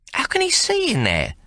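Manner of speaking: high, lightly voiced girl wondering; a curious young lass; Maggie asking. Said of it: "Maggie asks".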